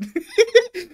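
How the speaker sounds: sudden high laughing burst; high burst of laughter. Real, not acted. Laughing at his own made-up line to the girl, high burst of laughter. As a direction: crack up at his own joke.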